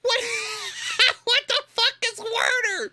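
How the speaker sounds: very high, loud shriek with laughter; comically outraged high yell; incredulous shout. Real, not acted.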